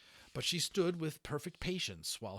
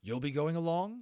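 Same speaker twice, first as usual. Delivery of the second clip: Holland's voice; 8 semitones higher, 7 dB louder than usual. Acted. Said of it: "that was Holland talking the whole time".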